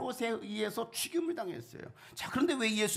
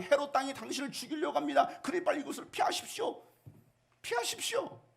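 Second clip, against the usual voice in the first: climbing higher into a frantic, unvoiced cry to flee; higher and higher, a hammed-up frightened plea; urgent warning; 8 semitones higher than usual. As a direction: panic as you urge him to run. Pharisees warning Jesus to flee.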